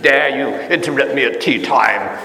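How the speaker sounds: put-on haughty British accent; snooty mock-British accent; condescending British accent. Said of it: "their very accent is kind of condescending".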